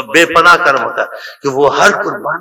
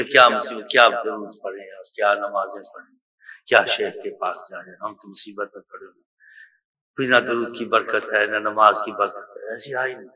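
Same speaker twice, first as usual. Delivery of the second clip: despondent doubter; 5 semitones lower, 8 dB quieter than usual. Retold or imitated. Voicing a despondent man's doubts in prayer.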